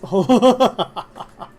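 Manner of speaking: a loud helpless laugh; laughing out at the cat. Real, not acted. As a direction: burst of real laughter. Cat breaks her view.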